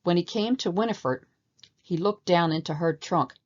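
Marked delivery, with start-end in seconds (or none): none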